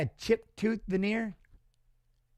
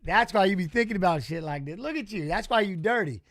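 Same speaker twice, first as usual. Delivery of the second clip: ordinary talk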